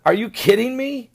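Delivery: mock disbelief. Lavrov scoffing at the reporter, mock disbelief. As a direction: scoff at the absurd question. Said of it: "turned around and said".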